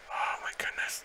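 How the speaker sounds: hushed, breathless awe; whisper. Real, not acted. As the battle gets tight, hushed whispered awe. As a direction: barely breathe the words.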